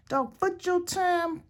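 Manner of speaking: high, slow put-on grandma voice; grandmother's voice. Repeating grandmother's saying, high slow mock granny. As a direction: drag it out like granny lecturing. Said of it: "That's what my grandmother would say".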